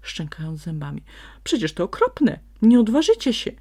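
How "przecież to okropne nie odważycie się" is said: high, loud panicky protest; frightened fox. A narrator's reading. Scared fox protests to the donkey and sprite, loud and high. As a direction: plead in terror.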